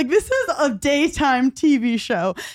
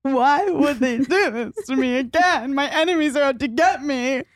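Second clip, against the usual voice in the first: slow, hammed-up self-pity, a bit higher; mock melodrama; 3 semitones higher than usual. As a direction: play the wounded victim of a tiny slight. Mock dramatic PMS self-pity.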